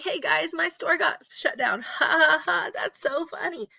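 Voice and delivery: high, giggly mock YouTuber voice; chirpy fake-cheerful influencer laughing it off. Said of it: "Let me know what YouTuber I'm talking about".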